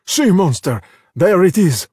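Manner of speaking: shout. A narrator's reading. Ercole spots the monster, harpoon in hand, shouting. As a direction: call the gang to the quarry. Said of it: "Ercole shouted".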